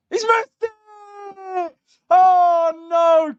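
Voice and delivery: a high, stretched cry, then a loud oh no; real shock as the kick is missed; dismayed cry. Real, not acted. A streamer watching the penalty saved, crying out high and loud.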